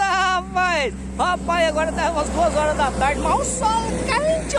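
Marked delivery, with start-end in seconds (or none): 3.2-4.6 drawn-out hot sun. Real, not acted.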